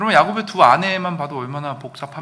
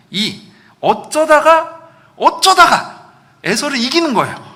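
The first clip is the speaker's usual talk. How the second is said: incredulous excitement; 10 semitones higher, 9 dB louder, slower than usual. Acted. Imagining Jacob somehow winning.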